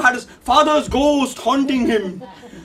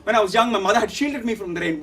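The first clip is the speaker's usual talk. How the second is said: plain reading voice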